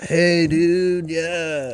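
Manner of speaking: lazy beach-bum dude impression; surfer dude voice. Mocking Californian surfer stereotype.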